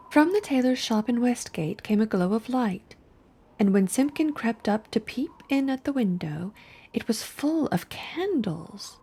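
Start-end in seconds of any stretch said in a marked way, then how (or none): none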